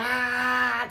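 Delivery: high, sustained mock holler. Inmate drowning out guard's questions, hollering. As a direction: hold a high wordless yell without much force. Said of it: "I kept hollering".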